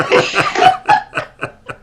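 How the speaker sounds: high, loud burst of laughter. Real, not acted. Guest asked by host to sing, high laughter.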